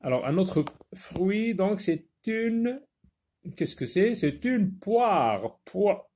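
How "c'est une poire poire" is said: "poire" drawn out high; careful classroom voice; slow teacher model. Shown as a demonstration. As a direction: say "poire" slowly, showing the "wa". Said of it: "Ija, ça".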